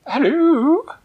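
very high, loud squeaky cook. Acted. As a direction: chirp it like a tiny cook. Kitchen cook answering phone.

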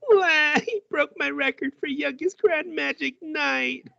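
mock crying. Rill bawling to the others over his lost record, high mock wail. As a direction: wail it out like a bratty kid bawling. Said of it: "Rill starts crying".

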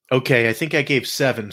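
ordinary talk

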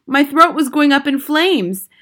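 high, loud, urgent telling of pain; agonized narration. A narrator's reading. Boy tells of potion burning through him, agonized narration.

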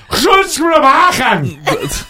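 slow, high-pitched shout of mock Dutch; hammed-up Klingon-like yell; mock shouting mom. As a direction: holler guttural gibberish like someone's mom. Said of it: "Your mom's over there going".

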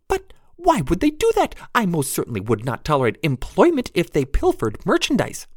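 tiny high pixie voice; prim, squeaky little pixie. Acted. Narrator voices pixie Jenny.

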